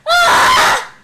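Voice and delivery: loud, high imitated scream. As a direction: scream like the comic character. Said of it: "he's just screaming like".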